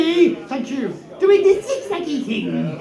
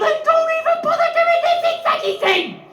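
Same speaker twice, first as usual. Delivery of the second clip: mock wail; 14 semitones higher, faster than usual.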